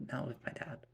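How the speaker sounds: whispered aside. Acted. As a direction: drop to a whisper.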